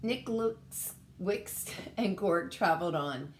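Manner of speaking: plain reading voice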